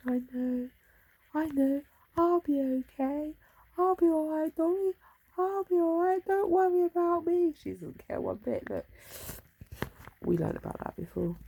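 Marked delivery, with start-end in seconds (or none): 0.1-7.5 high, sweet put-on cat voice, brave and reassuring; voicing the cat. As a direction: pitch way up and play the cat insisting she's fine.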